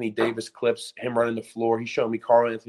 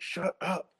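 high, softer plea under the breath; retold urgent hushing; 8 semitones higher, 5 dB quieter than usual. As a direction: discreetly shush an embarrassing parent. Retells nudging dad to stop.

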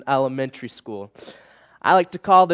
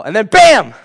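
"bam" punched out much louder, with a falling hit; dramatic crash; 4 semitones higher, 10 dB louder than usual. Acted. Youth pastor acting out the cookie jar smashing, loud punch. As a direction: slam the word "bam" like the crash.

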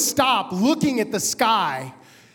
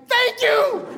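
very high, exuberant thanks; elated gratitude; 12 semitones higher than usual. Retold or imitated. Passenger thanking pilot for landing, overjoyed. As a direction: exclaim it way up high, thrilled.

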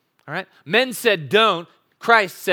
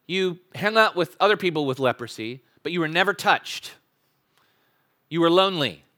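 usual speaking voice

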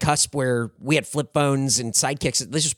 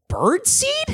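very high-pitched incredulous question; feigned bafflement; 4 dB louder than usual. Retold or imitated. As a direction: squeak it back as if you've never heard of it. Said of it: "just repeating it back".

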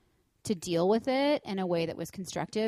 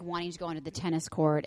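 ordinary talk, nothing marked

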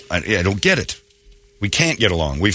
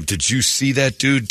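ordinary talk

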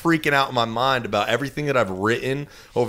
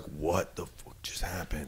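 stunned under breath; 5 semitones lower, 14 dB quieter, slower than usual. Retold or imitated. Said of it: "I was like".

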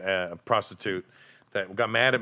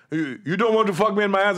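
higher and louder insistent mock come-on; high hammed-up hooker voice; 10 semitones higher, 7 dB louder than usual. Comic retelling of a club girl's pitch, higher and louder. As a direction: push the offer like the club girl. Prostitute voiced in retold story.

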